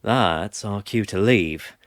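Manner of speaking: ordinary delivery, nothing marked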